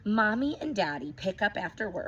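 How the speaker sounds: ordinary delivery, nothing marked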